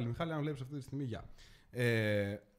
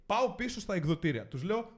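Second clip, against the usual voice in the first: ordinary talk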